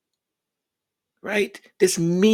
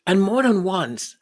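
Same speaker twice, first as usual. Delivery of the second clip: ordinary talk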